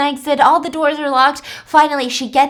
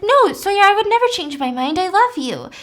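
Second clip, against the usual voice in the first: earnest David; 8 semitones higher than usual. Retold or imitated. David insisting to Sawyer he'd never change his mind, high earnest plea. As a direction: lift the voice up, insisting on his love.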